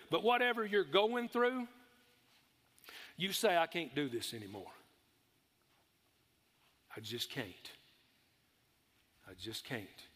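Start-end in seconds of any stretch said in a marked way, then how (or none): 3.6-4.7 weary despair. Retold or imitated. Quoting a worn-out believer. 7.0-7.7 quiet, defeated sigh of giving up. Retold or imitated. Preacher voicing a worn-out believer giving up, quiet and defeated. 9.3-10.1 the repeat fades even softer, almost gone; fading defeat. Retold or imitated. Preacher echoing the hopeless believer, fading to a near hush.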